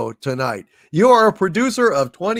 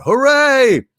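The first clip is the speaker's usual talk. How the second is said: high, loud mock cheer; 10 semitones higher, 6 dB louder than usual. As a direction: throw up a gleeful hooray. Reading a viewer's cheer aloud.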